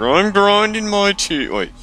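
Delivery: very high, slow squeaky gritted voice; hammed-up grind through the teeth. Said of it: "grinding their teeth".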